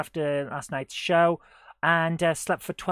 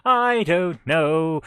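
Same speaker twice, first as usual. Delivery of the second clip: drawn-out loud; 8 dB louder, much slower than usual. Pretend. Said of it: "What am I even talking about?".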